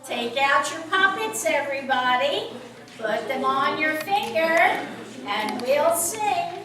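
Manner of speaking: ordinary delivery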